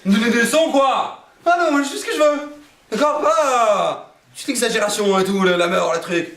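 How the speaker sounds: defiant patient. Retold or imitated. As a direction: play the arrogant guy brushing off the doctor. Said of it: "Et le mec, il fait". (patient arrogant, beaucoup plus aigu et indigné)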